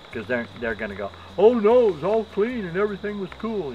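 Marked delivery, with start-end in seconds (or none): 1.4-3.7 high sing-song mimicry; mocking denial. Hospital staff denying the dirty bathroom, mocked high. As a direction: parody their excuse in a much higher voice.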